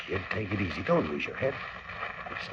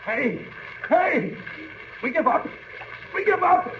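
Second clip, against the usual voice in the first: yelling; slower than usual. Acted. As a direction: yell to be heard outside. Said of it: "I'll yell out the window".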